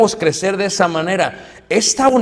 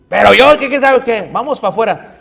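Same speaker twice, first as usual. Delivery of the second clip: loud, higher angry challenge; picking a fight; 5 semitones higher, 5 dB louder than usual. Acted. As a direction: dare him to step outside.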